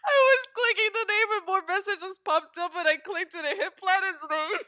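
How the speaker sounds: high, loud wail through tears of laughter; crying with laughter. Real, not acted. Streamer explains accidental ban, wailing with laughter. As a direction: explain in a helpless laughing squeal. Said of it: "I'm fucking crying".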